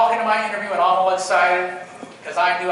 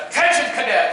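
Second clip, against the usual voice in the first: raised, commanding instructor voice; drill command; 4 semitones higher, 5 dB louder than usual. Retold or imitated. Drill instructor confronting a clueless cadet, commanding shout. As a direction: call the cadet to attention, higher and louder.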